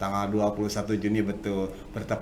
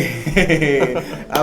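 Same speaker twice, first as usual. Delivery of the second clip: loud burst of laughter; hearty laugh; 3 semitones higher, 7 dB louder than usual. Real, not acted. Host greets guest with laughter. (tawa lepas yang keras)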